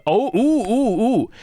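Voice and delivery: high, loud excited oohs; eager, high-pitched "I've got it" oohs; excited sudden idea. Real, not acted. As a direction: burst out as the idea hits.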